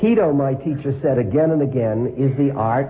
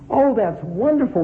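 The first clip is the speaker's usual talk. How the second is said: put-on much higher, cheerful exclamation of an old man; delighted old man; 9 semitones higher than usual. A narrator's reading. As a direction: gush with delight like a kindly grandpa. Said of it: "the old man said".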